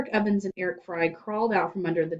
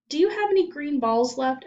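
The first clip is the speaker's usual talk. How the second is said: put-on girl's voice; 8 semitones higher, 5 dB louder than usual. A narrator's reading. Girl asks hopefully.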